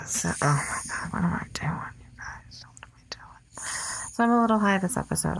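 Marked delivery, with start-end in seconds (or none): 0.0-3.7 sneaky stage whisper. Real, not acted. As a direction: whisper it like a secret. Giddy high confession to listeners.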